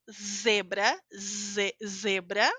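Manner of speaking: zebra with a buzzing Z at the start. Shown as a demonstration. Teacher drilling the Z in zebra. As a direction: buzz the Z in zebra. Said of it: "do dente vibrando".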